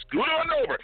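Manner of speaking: squeaky high joking voice; high playful quip. Retold or imitated. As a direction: toss it off in a high silly pitch. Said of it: "I was about to say".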